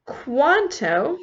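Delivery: "cuánto" said clearly as KWAN-to with a rising end; teacher models the word for the class; Spanish word. Shown as a demonstration. Teacher models "cuánto" with a rising end. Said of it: "which in Spanish is".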